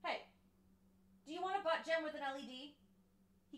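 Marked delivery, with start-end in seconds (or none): none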